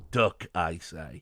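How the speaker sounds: low, drawn-out overruling; mild imitation of a man who won't be corrected; retold stubborn insistence. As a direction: lay it down slowly and low. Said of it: "and he goes".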